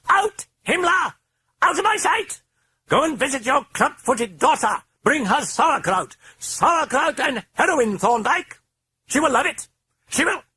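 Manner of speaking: very high, loud British tirade; furious British-accented ranting; raging British shout. A narrator's reading. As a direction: scream the servant out in British accent. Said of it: "hoarse British accented voice".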